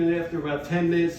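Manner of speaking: ordinary talk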